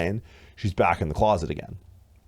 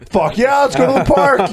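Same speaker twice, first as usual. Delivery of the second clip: very high, much louder overjoyed dog; excited dog voice; 10 dB louder than usual. Retold or imitated. Dog cheering about a trip to the park, ecstatic. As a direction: bark it out like a thrilled pup. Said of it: "The dog was like".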